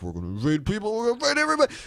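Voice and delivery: brash high climb on everybody; a hammed-up Romney promising war; mock Romney. Romney at the debate, rising to a high whoop. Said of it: "Mitt Romney's gonna be like".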